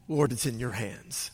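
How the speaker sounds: soft prayer. Acted. Quoted prayer.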